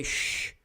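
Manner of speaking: long voiceless "sh" hiss; sustained hushing "sh", no vowel. Shown as a demonstration. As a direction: demonstrate the Carioca "sh" as a pure hiss. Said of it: "they have this hissing sound".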